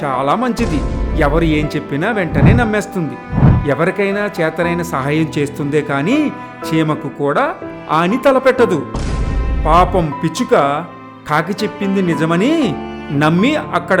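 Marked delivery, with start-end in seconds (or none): none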